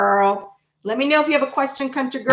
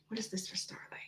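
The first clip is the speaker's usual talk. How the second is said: barely audible whispered question; 21 dB quieter than usual. Real, not acted. Reader asking Spirit about Starlight, whispered. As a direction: whisper it as a private plea to the cards.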